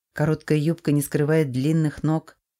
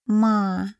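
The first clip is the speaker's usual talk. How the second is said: put-on higher, little boy calling mum; small child voice; 6 semitones higher than usual. A narrator's reading. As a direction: call out like a sleepy toddler. Sleepy child calls his mother.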